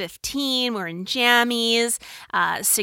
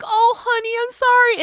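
comforting mom; 14 semitones higher, 4 dB louder than usual. Retold or imitated. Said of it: "I'm like".

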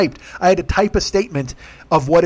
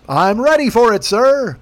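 higher, louder announcer voice like the movie phone guy; 5 semitones higher, 3 dB louder, slower than usual. Retold or imitated. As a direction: boom it out like a movie trailer announcer. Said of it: "He's like the movie phone guy".

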